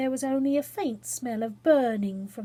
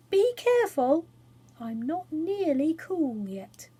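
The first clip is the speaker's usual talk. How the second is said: high bird voice; 6 semitones higher than usual. A narrator's reading. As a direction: speak as a proud magical bird. Phoenix speaks first.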